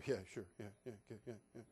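very low, fading mumble; dejected agreement. Retold or imitated. Suitor leaving her at the door, dejected mumbling.

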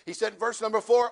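usual speaking voice

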